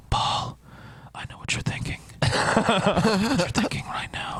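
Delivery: creepy whisper. Retold or imitated. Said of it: "Ben would whisper shit".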